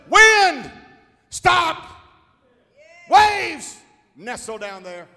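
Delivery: high, loud shouted commands; commanding the storm. Acted. Jesus commanding wind and waves, loud shout. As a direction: order the wind to stop. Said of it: "he turned around and said".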